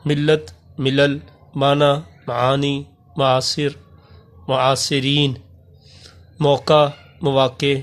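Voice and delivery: slow, clear reading of each Urdu word with pauses; careful word drill. Shown as a demonstration. Teacher reading plural forms to class, careful drill. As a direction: read each word crisply for students to copy.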